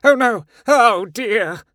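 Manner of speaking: loud, high, rising alarm; panicked cry. A narrator's reading. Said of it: "But then a cry".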